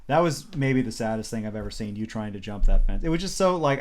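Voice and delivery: ordinary delivery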